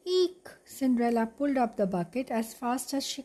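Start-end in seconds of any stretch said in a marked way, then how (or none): 0.0-0.5 high mock-frightened squeak; a tiny mouse's pretend squeal of fright. Gus teasing Cinderella from the well, a high squeak.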